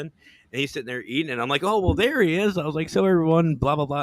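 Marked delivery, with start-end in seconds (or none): 1.6-4.0 live host voice. Retold or imitated. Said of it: "acting like I was live".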